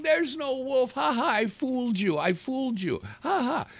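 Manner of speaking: high, taunting boy's voice with mock laughs. Boy laughing at fooled villagers, high mock taunt. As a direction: tease the villagers like a prankster kid.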